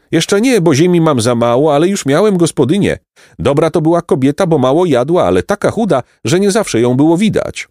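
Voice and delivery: high, lively farmer telling his tale; chatty peasant. A narrator's reading.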